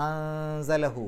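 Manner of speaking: slow അൻസലഹു with the ൻ hidden and hummed before സ; hidden nasal. Shown as a demonstration. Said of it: "മറച്ചു വെച്ചുകൊണ്ട് മണിക്കണം".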